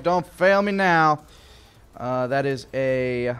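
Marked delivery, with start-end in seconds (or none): 0.0-1.2 pleading with dice. Real, not acted.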